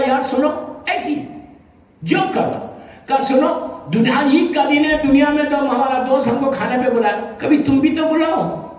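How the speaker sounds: high, teasing voice of a mischievous friend; cheeky prankster. Retold or imitated. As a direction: tease the grave like a cheeky joker. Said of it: "وہ بڑا شریر تھا". (شرارتی لہجے میں قبر سے مذاق)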